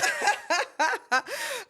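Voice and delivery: a high, loud laugh at a bold answer; real burst of laughter. Real, not acted.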